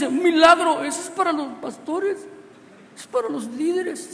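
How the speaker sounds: a man protesting that miracles are for pastors; mock outburst. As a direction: blurt it as if scandalised. Voicing the man's thought aloud.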